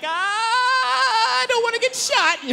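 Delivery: very high, slow, fearful crooning; mock nervous sing-song. Comic mocking a careful asker, high slow frightened singsong. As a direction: sing it out like a scared suburbanite. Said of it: "they're always really careful".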